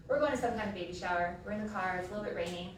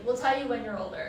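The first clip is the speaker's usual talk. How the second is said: deadpan sister; 3 semitones higher, 7 dB louder than usual. Retold or imitated. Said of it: "looks back and she's like".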